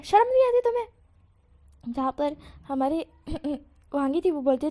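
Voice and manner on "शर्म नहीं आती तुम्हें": high, loud reproach; scolding shout. Acted. Husband scolding his wife in front of people, loud high shout. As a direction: shame her out loud.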